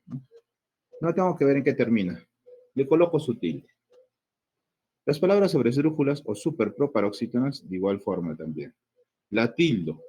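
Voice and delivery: normal talking voice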